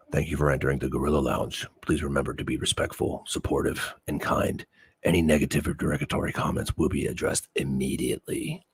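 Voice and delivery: rapid hushed fine print; fast quiet disclaimer. Pretend. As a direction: rattle off softly and quickly. Rapid soft disclaimer read.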